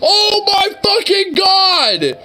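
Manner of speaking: high, loud and drawn out in disbelief; shocked outcry. Real, not acted. Watching cops set a dog on a man, shocked shout. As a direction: shout your shock at what you just saw. Reacting to dog attack video.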